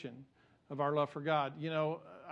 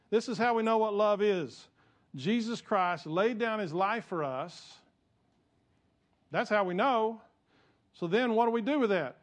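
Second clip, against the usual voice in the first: higher, louder, driving sermon voice; fiery preaching; 5 semitones higher, 5 dB louder than usual. Acted. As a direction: preach it with full force to the congregation. Preaching at full force.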